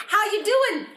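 high, cooing hello; hammed-up high greeting; playful pet-name greeting. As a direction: greet an object like a beloved child. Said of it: "find something in the room".

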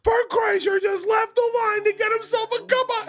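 high, slow astonished yell; a high, drawn-out yell of disbelief; mock shout. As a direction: shout it across the dining hall. Brother shouting across the hall.

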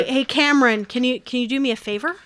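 ordinary talk

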